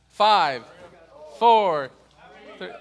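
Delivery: loud, punchy countdown; called-out countdown. Real, not acted. Teacher counting down to hurry the class, loud call. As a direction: call the numbers out over the room.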